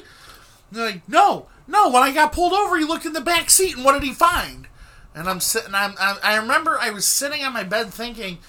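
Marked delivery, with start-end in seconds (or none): 1.1-4.7 high, loud aggrieved retort; exasperated explaining. Retold or imitated. Imitating his friend explaining the car search, exasperated. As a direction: build the complaint toward a pointed question.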